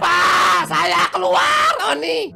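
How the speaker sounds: full shout of resignation; brave shout. Shown as a demonstration. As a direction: shout it with pride. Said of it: "Mana suaranya?".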